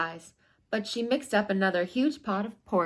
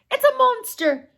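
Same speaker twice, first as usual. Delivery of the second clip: much higher, louder frightened moan; 10 dB louder than usual. Acted. Mama bear sees Goldilocks, a high loud frightened moan. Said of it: "moaned Mama Bear".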